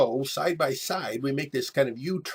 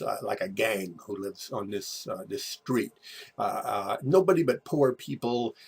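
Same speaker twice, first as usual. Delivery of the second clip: ordinary talk, nothing marked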